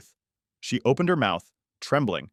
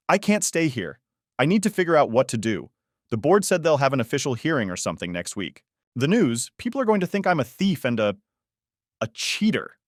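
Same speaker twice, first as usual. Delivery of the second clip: higher-pitched agitated outburst; mildly panicked, voice pitched up; fretful and worked up; 6 semitones higher than usual. A narrator's reading. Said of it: "Her voice cracked on the last word".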